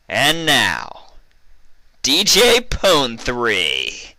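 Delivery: high, loud, drawn-out club announcer call; booming club MC voice; suave announcer. A narrator's reading. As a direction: announce the star DJ like a showman. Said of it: "A suave voice rang out from the speakers".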